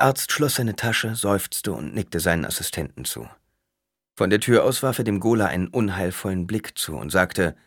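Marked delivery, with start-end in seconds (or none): none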